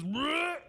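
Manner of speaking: high mock gag; imitated retch. John smelling the mess, imitated retch. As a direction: retch as if hit by a stink. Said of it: "smells it, and just goes".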